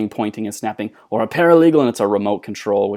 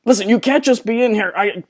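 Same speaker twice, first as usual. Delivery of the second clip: high, loud fed-up scold; exasperated scolding; 12 semitones higher, 6 dB louder than usual. Acted. Ballister ordering Nimona out, exasperated scolding. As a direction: tell her off, fed up.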